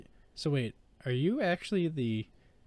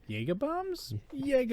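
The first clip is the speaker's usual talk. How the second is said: much higher, puzzled echo of the name; mock surprised mishearing; 12 semitones higher than usual. Podcast host imitating everyone mishearing the name, high puzzled. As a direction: hear Yaga as Jaeger and blurt it out, high. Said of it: "everyone turns like".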